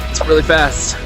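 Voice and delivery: excited. Real, not acted. Reacting to velocity readout.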